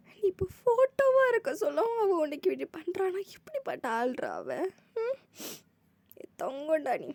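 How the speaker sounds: high tearful lament; mother weeping to the lost one's photo; mother crying. Acted. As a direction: grieve over the photo. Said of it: "அழுதுட்டு இருக்கிறாங்க".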